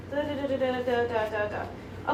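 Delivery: soft, trailing nonsense syllables; absent-minded skimming. Real, not acted. Reader hunting for a passage, absent-minded murmur. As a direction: murmur along while scanning a page.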